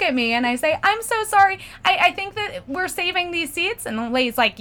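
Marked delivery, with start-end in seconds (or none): 0.8-3.9 mildly pleading, pitched-up apology; acted apology. Apologizing to women entering the row, high awkward voice.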